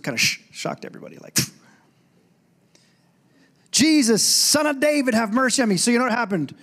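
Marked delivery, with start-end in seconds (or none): 3.7-5.8 sudden high-pitched outcry; imitated scream. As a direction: imitate someone crying out in church. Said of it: "heard somebody scream out that".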